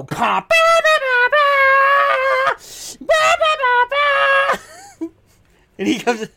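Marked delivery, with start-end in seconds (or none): 0.0-5.1 very high blaring "ba ba ba"; hammed-up vocal horn blasts; mock entrance fanfare. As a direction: trumpet it out with your voice. Imitates champion's entrance music.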